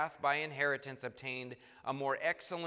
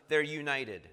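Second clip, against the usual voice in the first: usual speaking voice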